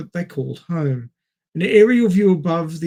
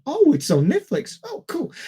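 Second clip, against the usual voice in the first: higher, lightly pleased discovery; a little lift of delight; pleased surprise; 10 semitones higher than usual. Retold or imitated. Retelling finding the movie, mildly pleased.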